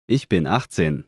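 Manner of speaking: ordinary delivery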